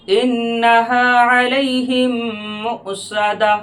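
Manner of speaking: slow, sung tajweed recitation; Quran recitation. Shown as a demonstration. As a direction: chant the verse with full tajweed melody. Recites verse melodically.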